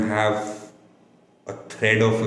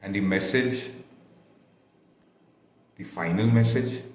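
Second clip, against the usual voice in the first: guided meditation. Acted. Leads a guided visualization.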